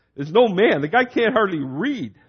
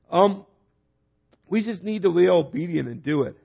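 ordinary delivery

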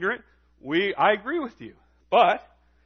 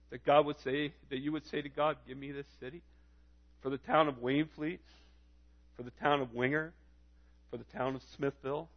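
soft, low prayerful appeal; name each town quietly, with long pauses; subdued call to pray, rising at each phrase end; hushed intercession; 4 semitones lower, 9 dB quieter than usual. Real, not acted.

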